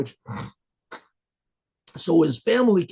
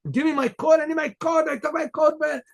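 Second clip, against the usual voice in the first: high, insistent mock outcry; frantic demand; 11 semitones higher than usual. Man begging the rabbi for his coat, frantic. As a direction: act out a man frantically pleading, pitch way up.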